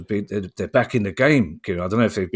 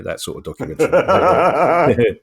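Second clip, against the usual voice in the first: laughing; 6 semitones higher, 5 dB louder, slower than usual. Real, not acted. Laughing wry punchline.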